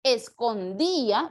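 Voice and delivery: "Escondía" in slow parts, stressed "dí"; sounded out. Shown as a demonstration. Teacher confirming an answer, "Escondía" slowly sounded out. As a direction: draw out "Escondía" to show the hiatus.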